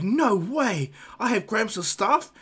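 high, loud, drawn-out disbelief; amazed excitement. Acted. Naruto learning he gets the staff, amazed. Boy thrilled to get the staff.

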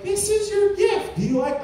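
high-pitched mock wife voice; playful high imitation of a wife; wife presenting a gift. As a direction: pitch it up like a pleased gift-giver.

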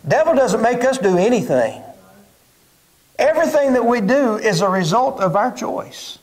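normal talking voice

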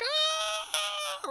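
sharp cry of panic; high panicked wail. Retold or imitated. Trapped outside his body, a high panicked wail. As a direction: wail in terror. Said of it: "I'm like".

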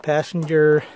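normal talking voice

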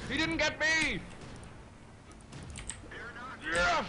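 elated cry. Real, not acted. Player tells team the shot missed, elated high cry. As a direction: shriek that you survived.

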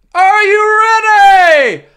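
slow, high, shouted Gladiators announcer call; Mark Clattenburg impression. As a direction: bellow it like a game show referee. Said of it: "my best Mark Clattenburg impression".

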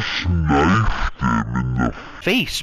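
low, very slow, drawn-out disbelief. Real, not acted. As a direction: stretch every word out low.

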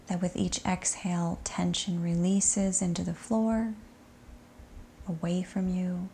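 soft, unhurried body-scan voice with long settling pauses; guided meditation. Acted. Yoga teacher guiding students to sink into shavasana, soft meditation voice.

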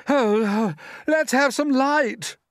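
loud, high, drawn-out cry; groan, then shout out into the dark; shouting demand. A narrator's reading. Centipede calling out in the dark, loud shout. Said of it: "shouted the centipede".

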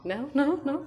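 very high, loud laughing coax; laughing pet talk. Real, not acted. Owner laughing as she offers Koko the toy, very high pet voice. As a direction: giggle while coaxing the dog.

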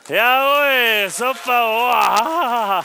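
loud, high cheer; proud exclamation; triumphant cheer. Real, not acted. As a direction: celebrate the catch out loud. After succeeding trick.

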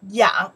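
yang delivered loudly as one syllable; a loud teaching model of yang; model pronunciation. Shown as a demonstration. Teacher pronounces the whole character for learners, loud yang. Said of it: "Whole character we pronounce".

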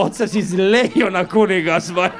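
slow, laughing mock disbelief; amused disbelief. Comedian asking the driver, slow and laughing. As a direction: drag it out, barely holding laughter.

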